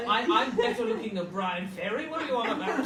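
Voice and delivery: mock-indignant laughing brag; cheeky dad laughing. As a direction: play the proud cheeky father, laughing. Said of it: "I said".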